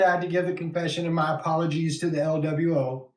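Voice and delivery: ordinary talk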